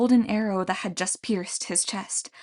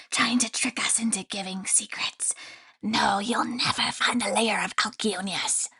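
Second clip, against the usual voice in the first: sinister whispering creature voice; creepy grain spirit whisper; 4 dB quieter than usual. Acted.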